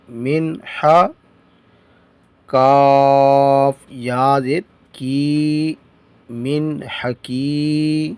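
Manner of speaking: slow, measured letter-by-letter recitation; slow spelling drill. Shown as a demonstration. Teacher drilling students in spelling, slow recitation. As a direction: chant each letter and vowel slowly.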